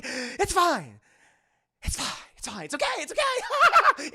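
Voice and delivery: high, strained it's fine, breaking into a laugh; forced calm. Retold or imitated. Brad insisting he's fine while scalded. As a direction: pretend it doesn't hurt.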